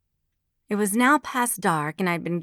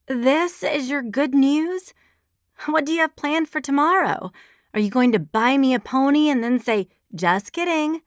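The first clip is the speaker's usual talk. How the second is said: sarcastic; 7 semitones higher, 4 dB louder than usual. A narrator's reading. Sarcastic reply to bad news.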